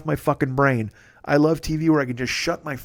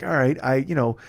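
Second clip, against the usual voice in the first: usual speaking voice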